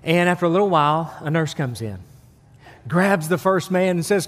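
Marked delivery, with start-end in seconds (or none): none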